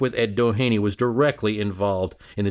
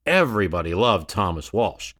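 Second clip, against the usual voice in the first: usual speaking voice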